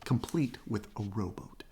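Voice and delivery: ordinary delivery, nothing marked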